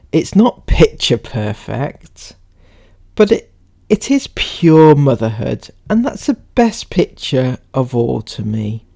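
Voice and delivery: measured, emphatic reading voice; read-aloud. Acted. Reading someone's post to viewers, read-aloud.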